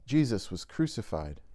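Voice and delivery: ordinary talk, nothing marked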